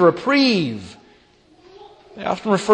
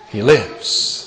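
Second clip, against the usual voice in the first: lower, softer falling repeat; hushed echo; 10 semitones lower, 6 dB quieter than usual. Acted. Preacher echoes the resurrection news to himself, hushed and low. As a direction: let it sink down to a hush.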